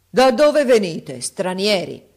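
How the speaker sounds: booming, slow call from a bronze giant; ringing giant's voice. A narrator's reading. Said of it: "la voce squillante".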